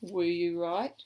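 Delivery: ordinary delivery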